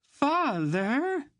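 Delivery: high, loud wail of father. A narrator's reading. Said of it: "Anhelde wailed".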